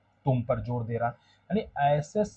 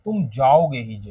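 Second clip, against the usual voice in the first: louder line, weight on "जाओगे ही"; stress after जाओगे; 6 dB louder than usual. Shown as a demonstration. Teacher to students: जाओगे stressed. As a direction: lean on जाओगे. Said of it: "किस पे जोर देता?".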